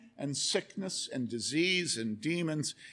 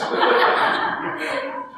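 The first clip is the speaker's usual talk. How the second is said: high delighted laugh; 8 dB louder than usual. Real, not acted. Delighted by answer.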